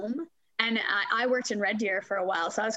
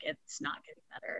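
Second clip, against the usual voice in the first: whispered, much softer and lower; defeated whisper; 5 semitones lower, 10 dB quieter than usual. Retold or imitated. Finally admitting it to her husband, whispered. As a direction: let it out as a beaten whisper.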